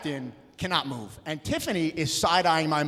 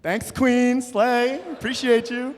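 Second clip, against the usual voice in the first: mock sassy thanks; campy thanks; 8 semitones higher, 6 dB louder, much slower than usual. Thanking the deli guy in a campy high voice. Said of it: "I was like".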